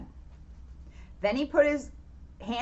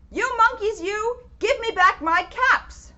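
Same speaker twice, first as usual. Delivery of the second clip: angry peddler; 10 semitones higher, 6 dB louder than usual. Acted. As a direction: shake both hands and demand them back. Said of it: "This made the peddler angry".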